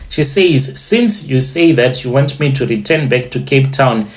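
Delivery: ordinary delivery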